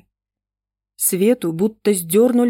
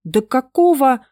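flaring up; 6 semitones higher, 6 dB louder than usual. A narrator's reading. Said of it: "вспылил было Гоша".

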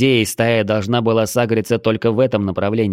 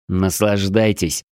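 usual speaking voice

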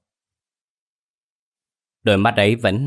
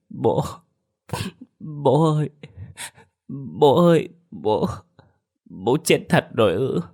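put-on high child's voice breaking on each call; sobbing child; 6 semitones higher than usual. A narrator's reading. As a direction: cry out for father, sobbing. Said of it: "vừa vuốt vừa khóc nức nở". (đứa trẻ khóc nức nở gọi bố)

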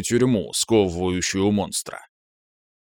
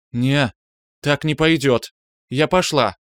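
put-on elf girl voice; 4 semitones higher, 3 dB louder than usual. A narrator's reading. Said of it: "произнесла Калантриэль".

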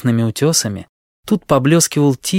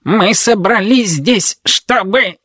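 slow, high, barking robot voice; creaky metallic robot; 8 semitones higher, slower than usual. Acted. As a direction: intone it like a creaky machine. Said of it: "пролаял скрипучий металлический голос".

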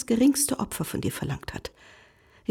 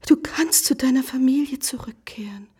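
ordinary delivery

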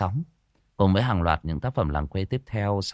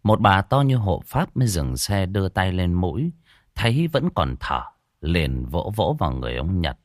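lively village ghost-story telling; storyteller voice for a spooky folk tale; story narration; 5 dB louder than usual. A narrator's reading. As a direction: draw the listener into the scene. Story narration after the intro.

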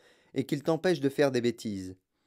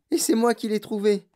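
put-on high, proud child's announcement; thrilled young boy; excited boy; 7 semitones higher, 3 dB louder than usual. A narrator's reading. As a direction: claim the find, pitched up and eager. Boy announces his winning ticket.

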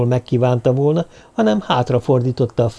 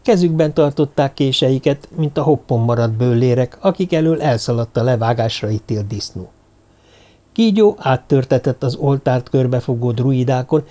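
usual speaking voice

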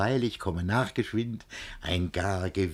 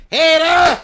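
much higher, louder yell; angry shout; 14 dB louder than usual. Acted. Master yelling at quarrelling servants. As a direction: bellow to get attention.

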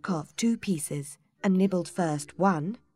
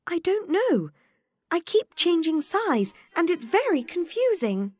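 put-on young Alice; 11 semitones higher, 5 dB louder than usual. A narrator's reading. Alice voiced in story.

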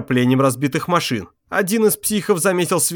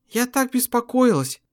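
high, slow, anxious woman's voice; worried wife; 9 semitones higher than usual. A narrator's reading. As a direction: let the worry slow her down.

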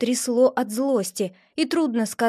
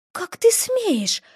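slow, higher shocked breath; shocked gasp; 8 semitones higher, much slower than usual. A narrator's reading. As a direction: breathe it out in disbelief. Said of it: "потрясённо выдохнула Риса".

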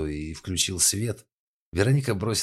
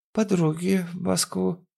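put-on drunk woman voice; 13 semitones higher, slower than usual.

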